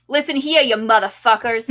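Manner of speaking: loud New York tough-guy growl, breaking into a laugh; loud, swaggering New York accent. Acted. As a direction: bark it at them like a New York bruiser. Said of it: "use your best New York accent".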